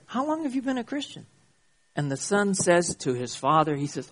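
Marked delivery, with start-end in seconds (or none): 0.1-1.3 high, quick, hushed exasperation; exasperated. Acted. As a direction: ask it fast and high, held-in exasperation. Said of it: "in a very exasperated way".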